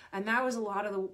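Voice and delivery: normal talking voice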